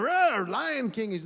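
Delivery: dad hamming up a lion to tempt his son, loud and slow; playful lion roar. Retold or imitated. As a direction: roar like a toy lion for a toddler. Dad roars as toy lion.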